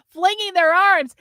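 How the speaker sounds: usual speaking voice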